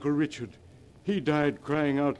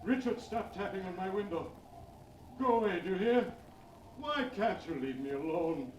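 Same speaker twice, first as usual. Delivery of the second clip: frightened, rising higher as he begs; terrified pleading; 5 semitones higher, 5 dB quieter than usual. Acted. Old man pleads with his dead brother's ghost, terrified. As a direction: beg the ghost to leave you alone.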